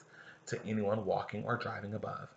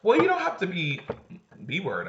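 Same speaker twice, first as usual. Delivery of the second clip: high, loud retort; sharp retort; 8 semitones higher, 7 dB louder than usual. Acted. [name] snapping at Kaylee, high and loud. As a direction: snap back at her. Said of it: "I say".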